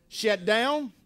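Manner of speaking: ordinary delivery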